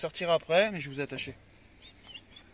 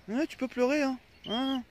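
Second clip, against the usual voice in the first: teasing dog; 9 semitones higher than usual. Real, not acted.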